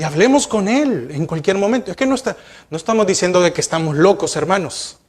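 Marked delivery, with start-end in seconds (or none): none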